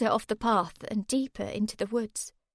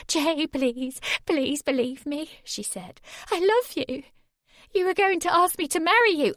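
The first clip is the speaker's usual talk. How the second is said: high tearful begging; desperate pleading; 7 semitones higher, 6 dB louder than usual. Acted. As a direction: beg him to believe. Sammy begs.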